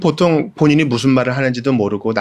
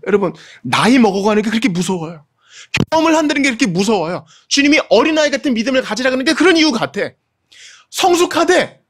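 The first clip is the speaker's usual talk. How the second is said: fervent preaching; 8 semitones higher than usual. Acted. Impassioned preaching climax.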